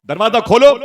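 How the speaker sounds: high, loud demand at the door; calling out. Acted. Grandfather outside the door demanding entry, loud and high. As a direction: call it through the closed door.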